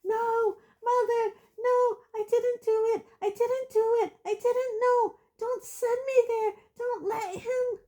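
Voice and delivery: high, frantic screaming plea. Acted. Boy screaming to his mother, high frantic plea. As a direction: scream and plead for your life. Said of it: "The boy began to scream".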